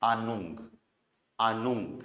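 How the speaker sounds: आनुंग with a long aa and an ng ending; teacher saying आनुंग; German pronunciation. Shown as a demonstration. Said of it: "गए एंड में".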